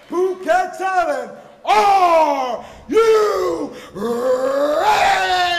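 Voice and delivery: booming stretched crowd call; ring announcer. Acted. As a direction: hype the arena, draw it out. Second, bigger crowd call.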